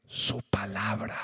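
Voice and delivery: breathy hushed whisper of the answer. Acted. Preacher whispering the answer to the church, hushed whisper. God breathed out his word.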